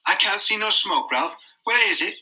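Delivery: put-on high, worried boy; anxious boy voice. A narrator's reading. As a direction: press him, puzzled and anxious. Piggy presses Ralph, anxious.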